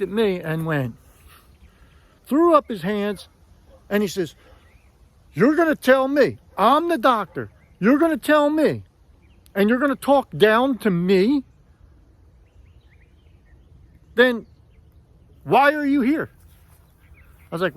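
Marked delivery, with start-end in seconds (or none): 5.3-16.3 imitating the dentist, higher and louder; angry dentist. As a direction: retell his indignant outburst, raised voice. Dentist angrily confronting patient.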